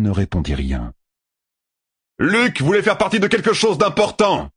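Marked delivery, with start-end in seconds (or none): 2.2-4.5 shouting. Acted. Tueur shouts at Perrine about Luc, very high. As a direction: hurl it across the valley. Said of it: "cria Tueur".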